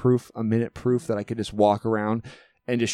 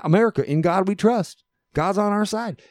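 higher, hammed-up Southern accent; mock Southern accent; 8 semitones higher than usual. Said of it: "we were talking in Southern accents".

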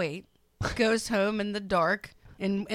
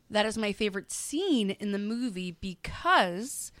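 usual speaking voice

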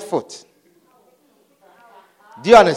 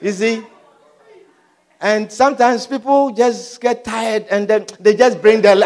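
normal talking voice

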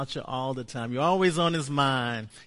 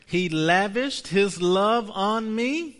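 slow confession; 5 semitones higher, 5 dB louder, slower than usual. Shown as a demonstration. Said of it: "Say this with me".

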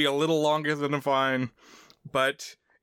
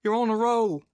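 higher, falling buddy call; teasing friend; 7 semitones higher than usual. Retold or imitated. Friend to guy playing a video game, high teasing call.

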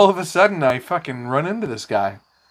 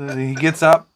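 usual speaking voice

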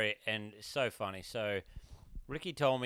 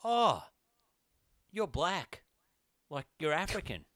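surprised bloke; 4 semitones higher, slower than usual. Retold or imitated. Retelling the security guard meeting Ricky, a sudden high shocked exclamation. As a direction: blurt it out like you didn't expect him. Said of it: "the bloke runs over to him and goes".

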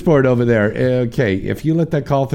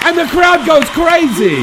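hype announcer; 5 dB louder than usual. Acted. Radio host introducing caller to imagined crowd, booming announcer shout. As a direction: announce her like a boxing champ entering.

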